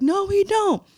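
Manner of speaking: very high retold contradiction; alarmed denial. Crew denying they have the chain, high alarmed voice.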